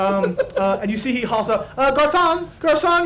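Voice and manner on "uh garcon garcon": high, louder call. Acted. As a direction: summon the waiter with fussy politeness. Whitnan calls the waiter.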